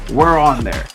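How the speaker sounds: ordinary talk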